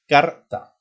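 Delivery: Carta with the r held and rolled; strong r in Carta. Shown as a demonstration. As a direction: roll the r in Carta, said loud. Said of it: "se pronuncia este tipo de R fuerte". (Carta con r fuerte marcada)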